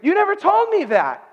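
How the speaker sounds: high, loud cry of protest; distressed outcry. Retold or imitated. Husband by his unconscious wife, distressed outcry. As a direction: cry out in disbelief, pitch way up.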